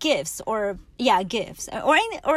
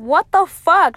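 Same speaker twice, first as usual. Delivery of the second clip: loud, high burst of disbelief; loud exasperated what the fuck; exasperated outburst; 7 semitones higher, 12 dB louder than usual. Retold or imitated. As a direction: throw up your hands and yell it. Frustrated outburst at rejections.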